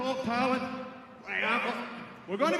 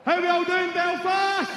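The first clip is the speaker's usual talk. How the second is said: higher, louder greeting to the crowd, held back a little; first crowd call; 5 semitones higher, 5 dB louder than usual. Acted. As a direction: open with a loud hello to the arena. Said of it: "Do you want me to do it again?".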